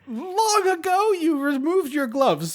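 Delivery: slow, drawn-out haughty retort; mock indignant. Host scolds husband about the gloves, mock-grand indignation.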